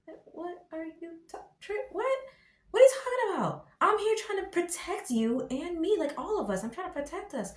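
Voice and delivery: high, exasperated protest; mock outraged husband; indignant husband. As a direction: plead your case in disbelief. Voicing the husband's indignant reply.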